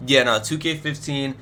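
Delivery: normal talking voice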